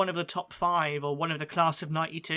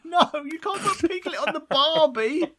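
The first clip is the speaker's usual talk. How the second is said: much higher, louder squeaky cartoon voice; mock protest; 11 semitones higher, 6 dB louder than usual. Co-host protesting in a high cartoon voice about Piglet. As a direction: play a horrified cartoon friend.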